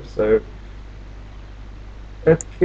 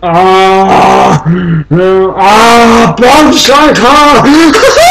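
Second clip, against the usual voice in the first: high, loud, laughing yell; excited shout; 7 semitones higher, 10 dB louder, much slower than usual. Real, not acted. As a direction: shout it out in delight. Excited shout at game event.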